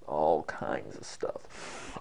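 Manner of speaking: slow, soft, very deep put-on voice; funny low voice. Shown as a demonstration. Teacher showing varied voices, funny low voice. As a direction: sink right down and draw it out. Cycling through voices on one phrase.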